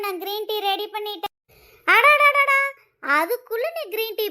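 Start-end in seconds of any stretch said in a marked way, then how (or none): 1.9-4.3 much higher, louder, wide-eyed gasp; mother-in-law exclaiming at the ready tea; exaggerated surprise. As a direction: overplay her delight at the tea.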